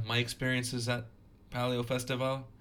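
Festival said the French way, festivAL; slipping into a French accent. Real, not acted. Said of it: "as if I'm trying to speak French with a terrible accent".

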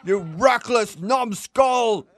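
high, slow mock shout; mock rage. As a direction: bellow insults in a silly voice. Host reveals prank, shouting.

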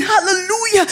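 mock prayer shout of hallelujah; retold shout. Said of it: "he'll be shouting".

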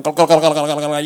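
loud gargling glug sounds; while gargling. Retold or imitated. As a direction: gargle it out loudly into the mic. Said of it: "you know, like that".

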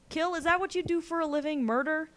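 high, outraged questioning; incredulous accusation. Acted. Boy confronts killer, glaring.

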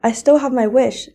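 ordinary delivery

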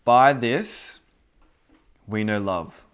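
loud, proclaiming the verse; scripture reading. Real, not acted. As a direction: announce the verse like a preacher. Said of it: "This is One John three sixteen".

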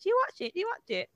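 high-pitched imitation of eager askers; mock nagging, pitched well up; pestering questioner. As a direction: mimic people pestering with the same question. Mimics people pestering her about show.

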